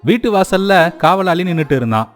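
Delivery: ordinary delivery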